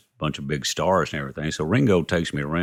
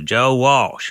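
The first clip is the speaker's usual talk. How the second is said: higher, louder repeat of a name; 8 semitones higher, 5 dB louder than usual. Retold or imitated. Caller repeats himself to a puzzled songwriter, insistent. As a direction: push the name out high and loud.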